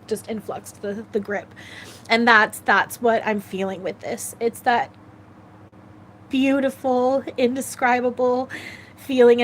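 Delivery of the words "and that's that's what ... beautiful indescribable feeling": tearful. Real, not acted. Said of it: "without crying".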